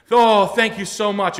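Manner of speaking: high, loud, slow mock-tearful thanks; overwhelmed relief. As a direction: gush in hammed-up relief. Customer's tearful relief, acted out.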